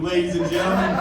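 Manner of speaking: ordinary delivery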